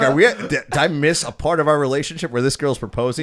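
comically panicked questioning; retold panic. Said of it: "I got scared as fuck".

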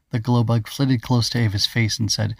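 plain reading voice

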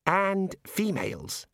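slow, hesitant suggestion; tentative, slow; hesitantly venturing. A narrator's reading. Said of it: "he ventured".

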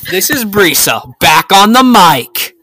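loud, drawn-out host intro; hyped podcast announcer, stretching the words. Pretend. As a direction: belt out a big, slow mic announcement.

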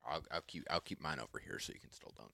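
quick hushed aside; whispering. Real, not acted. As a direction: whisper it fast so the mic barely hears. Quietly aside to co-host.

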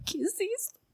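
high, hushed mock alarm; retold alarm. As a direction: squeak a small, soft "uy" of fright. Retells alarm on rainy flight. (susto fingido, agudo y bajito)

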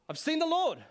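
high, loud cry of joy; Mary bursting out with joy to the disciples; joyful cry. Retold or imitated. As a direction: shout the good news with delight. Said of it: "she says to the disciples".

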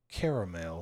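low, soft, mock-classy care-uh-mel; hammed-up refined order. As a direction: imitate someone putting on class, dropping low and soft. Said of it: "trying to be too high class".

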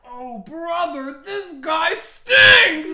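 high, very loud, drawn-out cartoon heckle; SpongeBob character. Doing a guy from SpongeBob, high drawn-out yell. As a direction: bellow it slowly in a cartoon voice. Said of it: "can I do my impression of that one guy".

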